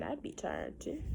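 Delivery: ordinary delivery, nothing marked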